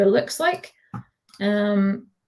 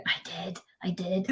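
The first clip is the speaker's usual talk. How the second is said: sheepish whispered admission; whispering; 12 dB quieter than usual. Real, not acted. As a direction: quietly confess it, almost breathless. Sheepish confession after denying thesaurus.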